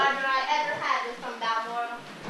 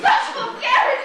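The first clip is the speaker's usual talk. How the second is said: indignant exclamation; 7 semitones higher, 6 dB louder than usual. Acted. Crane offended by remark.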